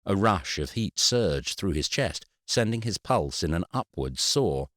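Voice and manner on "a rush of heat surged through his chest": usual speaking voice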